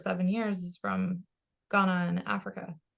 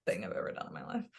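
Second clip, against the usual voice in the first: quick hushed whisper; 12 semitones lower, 8 dB quieter, much faster than usual. Real, not acted. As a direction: confess it under your breath. Confessing aside to camera.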